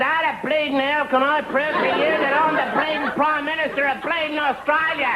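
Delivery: high, indignant hammed-up outburst; exasperated Australian PM. Prime Minister snaps at Saint Peter in heaven, high exasperated.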